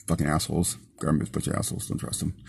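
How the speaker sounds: low, soft muttering under the breath; muttered curse. Real, not acted. Driver grumbling about the government, soft bitter mutter. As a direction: grumble it half to yourself, fading to a whisper.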